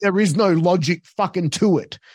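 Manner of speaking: exasperated explaining. Retold or imitated. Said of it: "I said".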